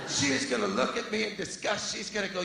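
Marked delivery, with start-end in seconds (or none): none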